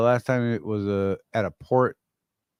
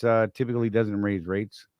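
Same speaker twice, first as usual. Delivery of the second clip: ordinary delivery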